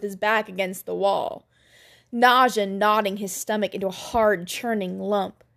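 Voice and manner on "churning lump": ordinary delivery, nothing marked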